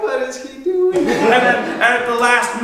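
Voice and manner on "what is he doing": very high-pitched alarmed squeal; comic panicked aside from the ranks; aghast onlooker. Acted. Soldiers voiced aghast at Achilles.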